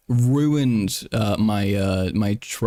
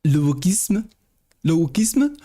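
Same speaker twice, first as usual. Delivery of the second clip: high-pitched, incredulous French question; mock French alarm; 9 semitones higher than usual. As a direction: ask it like a scandalized cartoon Frenchman. Mock French panic at wokeness.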